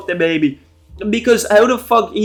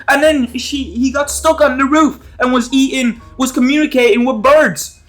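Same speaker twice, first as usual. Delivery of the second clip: panicked confession; 7 semitones higher than usual. Retold or imitated. Josh frantically confessing to parents.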